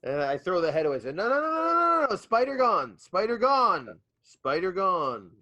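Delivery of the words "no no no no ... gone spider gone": calling up to giant. Acted. Player shouting reassurance up at giant.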